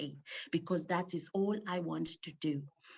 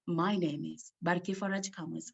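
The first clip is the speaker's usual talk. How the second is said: ordinary talk, nothing marked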